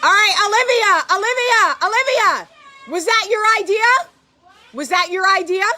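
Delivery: loud high call to her daughter outside; calling out loudly. Real, not acted. Mom yelling out at her kid Olivia, loud and high. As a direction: shout across the yard at Olivia.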